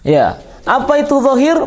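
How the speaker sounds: normal talking voice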